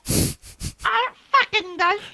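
high squeaky mimicking voice; mocking imitation. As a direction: parrot him in a silly squeak. Mocking friend's retort.

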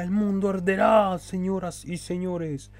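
high, slow villain proclamation; mustache twirling villain. Pretend. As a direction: gloat like a cartoon villain. Villain boast after evil laugh.

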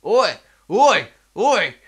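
high loud yelled calls; yelling at a mate down the road; shouting oi. Retold or imitated. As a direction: bellow across the street. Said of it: "the other guy came out and he's like".

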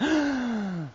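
sharp surprised intake of breath; mock gasp. New believer gasping at his discovery. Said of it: "I thought".

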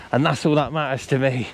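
ordinary delivery

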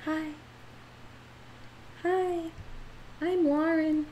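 soft, high, timid introduction; acted shy. Shown as a demonstration. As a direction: shrink back and say it small and high. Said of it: "That's shy".

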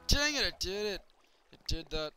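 high, loud wail of frustration over ruined crops; frustrated outburst. Real, not acted. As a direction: yelp and groan as it all goes wrong. Water ruins his farm again.

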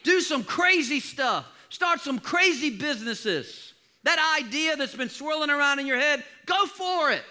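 high, loud, slow preacher's charge; rally the crowd to take risks; preaching at full force. Acted. Preacher urging the church to step out, fervent and loud.

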